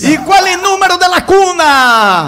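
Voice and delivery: loud high slow cries; ritual goal shout. Pretend. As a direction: belt it like a radio call. Classic goal-call ritual questions.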